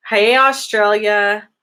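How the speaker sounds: ordinary talk, nothing marked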